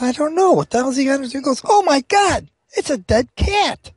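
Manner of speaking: high, shocked gasp of disbelief; shocked saloon keeper. Acted. The saloon keeper sees the dead cat, voice shooting up in shock. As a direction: recoil at the sight.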